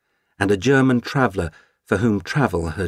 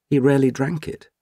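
usual speaking voice

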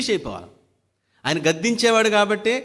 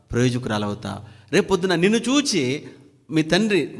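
usual speaking voice

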